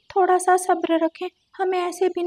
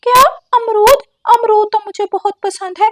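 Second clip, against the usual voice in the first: excited parrot; 6 semitones higher, 6 dB louder than usual. Acted. Parrot excited about guavas.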